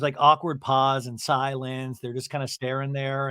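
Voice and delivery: ordinary delivery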